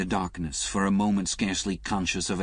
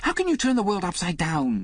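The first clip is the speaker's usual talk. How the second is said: much higher, loud outburst; sudden shout; 12 semitones higher, 5 dB louder than usual. A narrator's reading. Liz suddenly shouting at Alec. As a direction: burst out shouting. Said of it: "Liz shouted suddenly".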